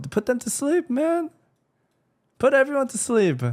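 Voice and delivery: high mock villain voice. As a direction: squeal it out in mock triumph. Mocking Miquella's plan, louder.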